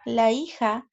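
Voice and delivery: usual speaking voice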